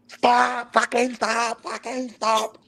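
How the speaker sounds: angry coach. Retold or imitated. Coach halting training, mock angry high yell. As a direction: bark it like a furious coach stopping the session. Coach yelling at player in session.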